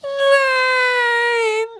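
very high, loud crying shriek. A narrator's reading. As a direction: shriek it in despair. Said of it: "kreischte Winky".

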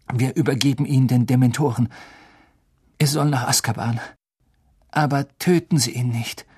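usual speaking voice

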